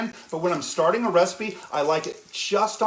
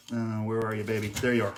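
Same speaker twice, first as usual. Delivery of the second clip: low, soft coaxing murmur; coaxing the chicken; 9 semitones lower, 6 dB quieter than usual. Real, not acted. Cook talking to a hidden chicken piece, softly coaxing. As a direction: coax it out like calling a pet.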